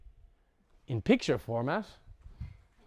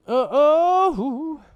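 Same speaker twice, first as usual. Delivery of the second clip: much higher, louder playful uh-oh; mock alarm; 12 semitones higher, 9 dB louder than usual. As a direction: tease the class with fake alarm. Teacher springs a self-test.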